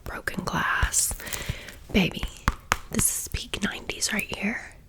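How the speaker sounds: usual speaking voice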